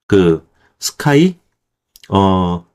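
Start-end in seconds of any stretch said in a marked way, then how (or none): none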